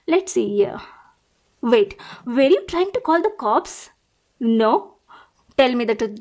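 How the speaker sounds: usual speaking voice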